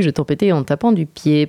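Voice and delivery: usual speaking voice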